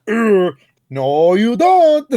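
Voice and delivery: high, loud, grunting ape-like outburst; big cartoon holler after a grunt; Donkey Kong. Host acting out the changed game line, cartoon yell. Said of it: "And then D K says".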